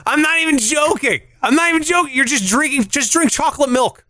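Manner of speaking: high-pitched, worked-up insistence; exasperated. Real, not acted. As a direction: argue it indignantly, voice riding high. Heated mock argument over cereal.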